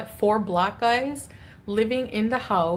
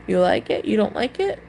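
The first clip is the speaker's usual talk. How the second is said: usual speaking voice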